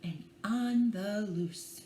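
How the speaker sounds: put-on gentle read-aloud for small children; bedtime story reading. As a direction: lull them along with the rhyme. Reads picture book aloud.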